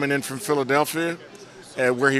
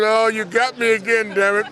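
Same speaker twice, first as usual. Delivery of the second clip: 1 caught out; 7 semitones higher, 4 dB louder than usual. Retold or imitated. Realizing the prank was on him.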